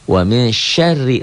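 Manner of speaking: "syarri" with a thin, light r, chanted slowly. Shown as a demonstration. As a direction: recite "Wa min syarri" high and slow, keeping the r light. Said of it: "ro-nya tipis". ("syarri" dengan ro tipis)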